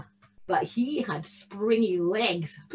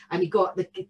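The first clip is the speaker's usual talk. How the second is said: ordinary delivery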